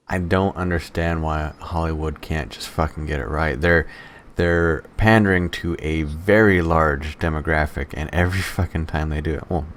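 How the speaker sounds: normal talking voice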